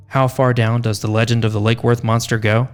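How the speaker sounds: usual speaking voice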